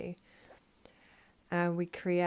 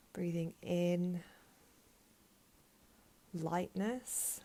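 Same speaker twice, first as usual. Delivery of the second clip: guided meditation; 3 dB quieter than usual. Real, not acted. Teacher guiding listeners to breathe in lightness and exhale heaviness, guided meditation voice. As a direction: lead the breath calmly, leaving space after each phrase.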